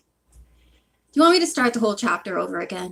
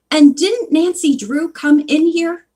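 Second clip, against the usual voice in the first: raised, louder challenge; emphatic, pitched-up questioning; insistent wife; 7 semitones higher, 6 dB louder than usual. A narrator's reading. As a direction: press the point as an insistent wife. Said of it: "insisted his wife".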